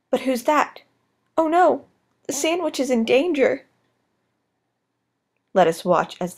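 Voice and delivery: hushed wildlife documentary narrator; nature documentary. Acted. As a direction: narrate like a nature film host.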